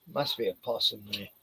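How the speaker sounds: usual speaking voice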